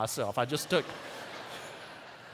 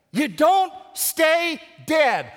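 high, loud, drawn-out proclamation; preached shout; 11 semitones higher, 10 dB louder, much slower than usual. Real, not acted. Pastor repeating the point to the congregation, preached shout. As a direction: shout it out word by slow word.

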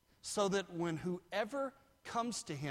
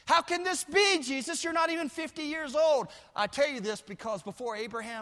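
loud, much higher mocking challenge; full-force preaching; 11 semitones higher, 9 dB louder, faster than usual. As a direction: fling the crowd's question back.

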